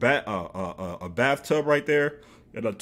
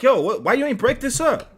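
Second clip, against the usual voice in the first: high, loud incredulous challenge; retold baffled outburst; alarmed confronting; 13 semitones higher, 6 dB louder than usual. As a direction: demand answers, pitched way up.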